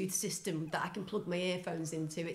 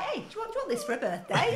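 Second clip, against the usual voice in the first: high, chirpy dodgy seller; salesman voice; 13 semitones higher, 5 dB louder, faster than usual. Retold or imitated. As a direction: pitch it like a shifty trader. Seller offering budgie with guitar.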